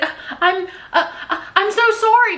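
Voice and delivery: high, stammering mock apology; flustered apology. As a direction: act out a panicked, tongue-tied sorry. Stammered apology after being cussed out.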